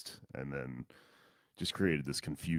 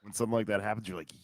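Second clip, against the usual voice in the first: ordinary delivery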